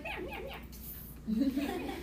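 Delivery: slow, high cooing meow to a little dog; mock baby talk to a dog. Owner cooing at her little rat dog, slow mock baby talk. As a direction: stretch each meow like cooing at a pet.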